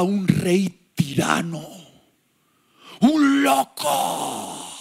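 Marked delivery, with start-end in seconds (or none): 3.0-4.7 slow drawn-out voice of Andrés. Acted. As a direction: stretch it out like Andrés. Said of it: "Así me ha salido como voz de Andrés".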